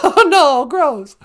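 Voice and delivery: high, loud laughing protest; real amused squeal of disgust. Real, not acted. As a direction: recoil while laughing. Listener reacts to gory ending.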